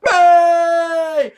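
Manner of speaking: high, loud drawn-out cheer; imitated crowd cheer. Reviewer re-enacting the crowd's reaction to the promo, long cheering shout. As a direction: belt it out like a whole crowd erupting. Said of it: "to a man, myself included, sort of goes".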